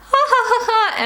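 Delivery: high imitated ha-ha laugh, pitched well up; a hammed-up burst of laughing in public; mock laughter. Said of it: "if you're laughing, you're like".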